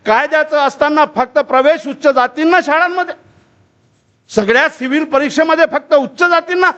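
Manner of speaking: high, sustained oratorical protest; impassioned demand. Acted. Phule petitioning the British for rights, impassioned high demand. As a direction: declaim it high, stretching the final question.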